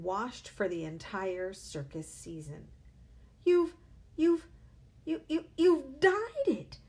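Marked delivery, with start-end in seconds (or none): 3.4-6.8 stammering high loud shriek. A narrator's reading. Mother shrieking at her husband over his dyed hair, stammering and loud. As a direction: sputter it out in horror. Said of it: "shrieked the mother".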